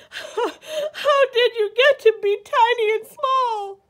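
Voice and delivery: high, loud, hammed-up wail; bawling. A narrator's reading. Sobbing bear to his tiny teddy, bawling. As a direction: bawl it out like a storybook crybaby. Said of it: "could hear the voice bawl".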